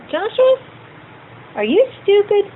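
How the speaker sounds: high, lilting mock question to a small child; playful mother question. Mother gently testing her small son, high and playful. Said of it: "I'd go".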